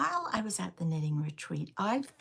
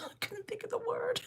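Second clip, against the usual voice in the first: laughing whisper; 4 dB quieter than usual. Real, not acted. Laughs at forgetting a word.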